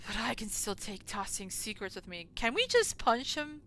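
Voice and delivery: ordinary talk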